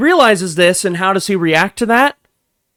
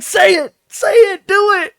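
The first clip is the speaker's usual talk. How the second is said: very high falsetto squeal; goading in a tiny high-pitched voice; gleeful egging on. Retold or imitated. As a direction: mimic an excited onlooker, squeaky high. Acts out egging the man on.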